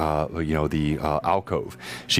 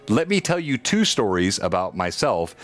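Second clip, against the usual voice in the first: ordinary talk, nothing marked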